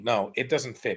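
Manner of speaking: usual speaking voice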